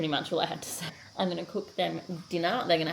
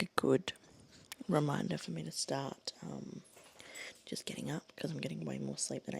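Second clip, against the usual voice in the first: quiet at night; 3 semitones lower, 7 dB quieter than usual. Real, not acted. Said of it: "trying to be really quiet".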